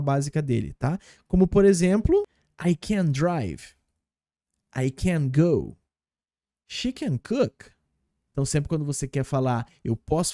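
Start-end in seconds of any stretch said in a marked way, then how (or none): 2.6-7.7 slow model. Shown as a demonstration. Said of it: "teacher models example sentences".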